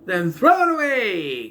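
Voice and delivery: high, slow quoted order; acted exclamation. Reviewer quoting a cartoon joke, acted exclamation. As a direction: draw it out high, falling at the end.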